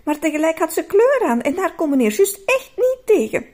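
usual speaking voice

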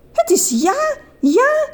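very high, exultant yes; joyful cry. A narrator's reading. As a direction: cry out with delight. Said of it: "riep ze uit".

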